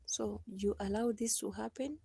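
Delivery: soft, hushed plea to God; whispered prayer. Retold or imitated. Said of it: "And I was like".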